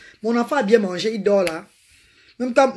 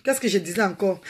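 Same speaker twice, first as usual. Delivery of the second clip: normal talking voice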